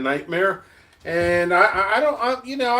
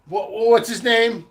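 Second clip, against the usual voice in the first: usual speaking voice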